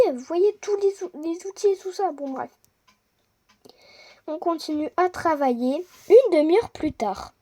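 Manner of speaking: ordinary delivery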